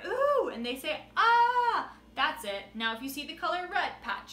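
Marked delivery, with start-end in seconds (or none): 0.1-1.9 high, loud, slow ooh with a laugh; even louder call. Shown as a demonstration. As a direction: belt it out, stretched and laughing. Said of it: "even louder".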